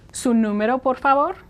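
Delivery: polite question climbing on favor; customer service request. Pretend. Clerk asks a customer for their number, polite rising request. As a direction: request it courteously with a rising end. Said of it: "you're in a customer service situation".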